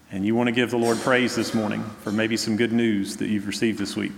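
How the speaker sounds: ordinary delivery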